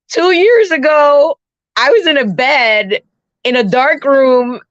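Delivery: retold tearful wail; tearful, crying. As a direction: imitate talking through sobs, easing off gradually. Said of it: "I'm, like, crying hard".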